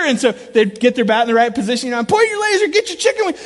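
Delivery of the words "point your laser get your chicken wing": high, loud coaching yell. Retold or imitated. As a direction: yell it to the kids at bat. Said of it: "I'm yelling all this stuff".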